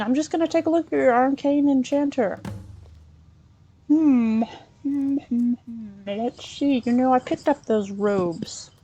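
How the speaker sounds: ordinary delivery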